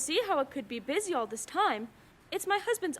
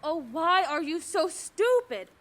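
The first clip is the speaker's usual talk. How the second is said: slow, louder, fed-up scolding; exasperated snap; 5 dB louder, slower than usual. Acted. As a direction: lash out at the dim operator. Exasperated at operator.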